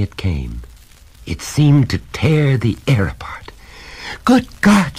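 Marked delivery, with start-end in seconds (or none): 4.3-5.0 high, loud alarm; sudden frightened outburst; horrified cry. A narrator's reading. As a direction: cry out in shock. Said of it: "she cried".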